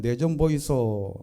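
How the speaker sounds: ordinary talk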